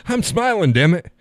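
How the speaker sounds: half-laughing insistence; exasperated mock protest. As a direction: protest that you are smiling. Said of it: "Damn, I look pissed off".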